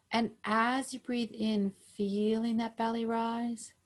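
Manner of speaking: calm, even breathing-guide voice; guided meditation. Acted. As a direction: pace each cue with the breath, unhurried.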